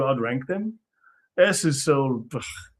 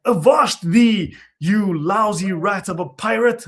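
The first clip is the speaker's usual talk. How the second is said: slow, higher, louder mock stage voice; hammy stage actor; 4 semitones higher, 4 dB louder, slower than usual. Mocking theater kids, a hammed-up stage challenge. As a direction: ham it up like a school play pirate. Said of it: "Like, stage play kids".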